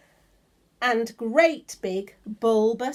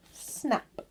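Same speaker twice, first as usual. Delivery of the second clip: whispered snap; 7 dB quieter than usual. Shown as a demonstration. Reading sound word from book.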